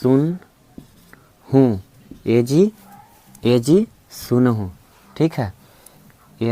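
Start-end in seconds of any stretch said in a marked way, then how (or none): 0.0-4.7 slow, stretched "ए जी सुन हूँ"; slow sing-song call to the husband; drawn-out wife's call. Shown as a demonstration. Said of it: "तो आप सुनिए ना".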